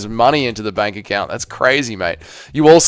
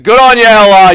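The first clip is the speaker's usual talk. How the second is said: loud praise; 7 semitones higher, 10 dB louder than usual. Real, not acted. Congratulating a viewer.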